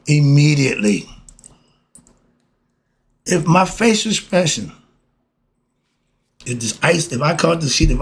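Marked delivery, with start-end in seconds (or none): none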